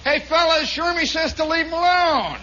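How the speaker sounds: high, loud call to the whole room; hollered relay with a long last word; mocking announcement. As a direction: broadcast the plea to make fun of it. Somebody mockingly announces it to all.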